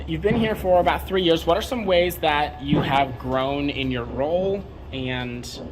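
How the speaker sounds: usual speaking voice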